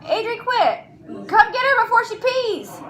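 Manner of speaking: high-pitched urgent call; real pressing call, pitched well up; urgent parental command. Real, not acted. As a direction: summon help before an accident happens. Orders child, summons partner to help.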